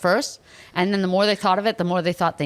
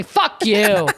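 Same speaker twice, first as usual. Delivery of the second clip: a louder spat insult; light imitation of a bitter colleague; mock hostile jab; 3 semitones higher, 5 dB louder than usual. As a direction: throw it at her like a passing heckler. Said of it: "Somebody goes to me".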